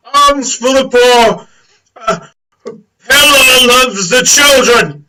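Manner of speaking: booming, high, stretched-out cry; calling for alms. Acted. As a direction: holler it slowly across the crowd. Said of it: "And he said".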